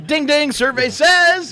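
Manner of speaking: high, loud, slow announcer call; mock Family Feud announcer; game show host.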